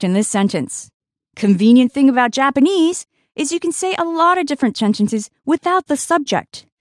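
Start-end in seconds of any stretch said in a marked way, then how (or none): none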